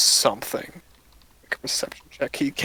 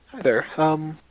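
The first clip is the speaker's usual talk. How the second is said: lightly voiced cheerful shopkeeper, a bit faster at the end; friendly shopkeeper; 5 semitones higher than usual. Shopkeeper greeting two adventurers, lightly voiced and friendly. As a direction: greet new customers brightly.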